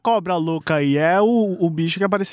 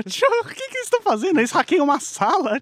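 put-on high fast kid voice; 7 semitones higher, faster than usual. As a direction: squeak it out like a curious kid.

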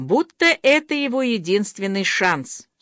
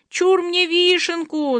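excited cry; 10 semitones higher than usual. A narrator's reading. Masha claims the cherry on the cake, crying out high. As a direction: call dibs in a high childlike cry. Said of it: "закричала Маша".

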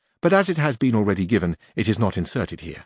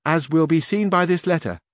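high, louder page voice; young page voice, raised and loud; 7 semitones higher, 3 dB louder than usual. A narrator's reading. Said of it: "said the page".

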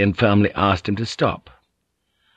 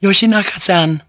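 high, raised call; authoritative call; 11 semitones higher, 4 dB louder than usual. A narrator's reading. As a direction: call out to summon him with authority. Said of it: "she called out with authority".

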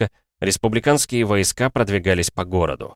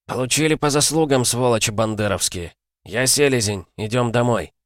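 raised, gloating victory call; triumphant radio call; 4 semitones higher than usual. A narrator's reading. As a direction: cheer the kill over the radio. Commander triumphant over radio.

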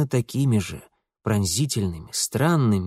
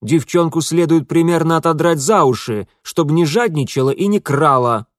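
somewhat raised, cross man's voice; irritated scolding; 4 semitones higher, 6 dB louder than usual. A narrator's reading. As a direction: scold crossly, a touch louder. Irritated man scolds the girl.

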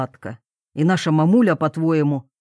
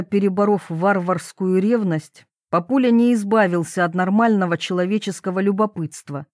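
ordinary delivery

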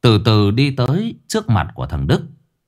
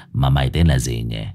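cold low boss; 10 semitones lower than usual. Acted. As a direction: ask like a bored gang boss. Boss asks coldly.

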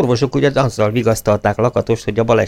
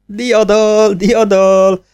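high, louder cry of victory; triumphant shout; 12 semitones higher, 5 dB louder, much slower than usual. A narrator's reading. Young man bursting in to cheer up the gloomy team. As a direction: burst in shouting the good news. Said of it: "kiáltotta".

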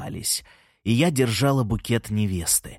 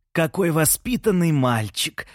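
slow, higher-pitched exclamation of approval; raised pitch, savouring each word; admiring exclamation; 6 semitones higher than usual. A narrator's reading. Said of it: "воскликнула Елена-старшая".

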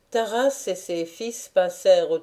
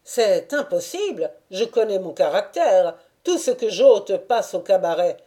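raised voice; 5 dB louder than usual. A narrator's reading. Dialogue Taras and dancer.

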